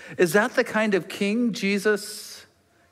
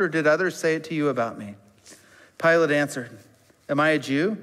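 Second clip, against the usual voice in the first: lower, measured Bible reading; scripture reading; 5 semitones lower than usual. Acted. Preacher reading John to his congregation, measured. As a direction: read the passage plainly to the church. Reads John chapter eighteen.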